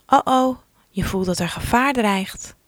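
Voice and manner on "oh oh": mock alarm. Sudden danger in turtle story.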